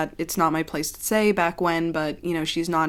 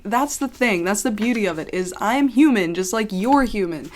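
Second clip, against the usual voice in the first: usual speaking voice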